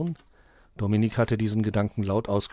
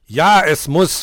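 loud, raised, cross snap; snapping angrily; 5 semitones higher, 11 dB louder, slower than usual. A narrator's reading. Nikolaus answers the whining apprentice, snapping angrily. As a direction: snap back with full force. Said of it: "fauchte der Nikolaus".

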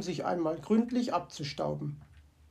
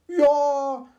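higher, louder surprised ja; delighted start at a find; mock surprise; 7 semitones higher, 8 dB louder than usual. Fox finds bottle.

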